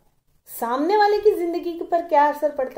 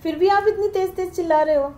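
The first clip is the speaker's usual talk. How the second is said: higher, anxious begging to stop the shouting; frightened pleading; 6 semitones higher, 3 dB louder, faster than usual. Acted. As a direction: plead nervously, voice raised in pitch. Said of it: "वो फेरी वाला डर गया".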